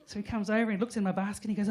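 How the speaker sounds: ordinary talk, nothing marked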